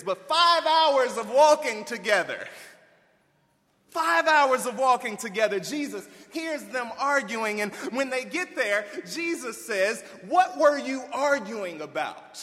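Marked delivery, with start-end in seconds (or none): 0.3-5.6 louder, higher, pressing the point; full-force preaching; preaching emphasis. Real, not acted. Preacher stressing long walk. 10.3-12.4 louder, higher, pointed question; Jesus calling out his disciples loudly; demanding question. Retold or imitated.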